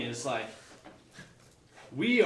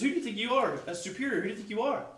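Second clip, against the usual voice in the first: indignant challenge; 8 semitones higher, faster than usual. Acted. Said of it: "So he says".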